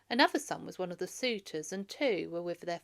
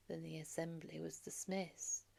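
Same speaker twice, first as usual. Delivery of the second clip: usual speaking voice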